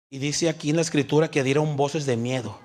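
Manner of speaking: ordinary talk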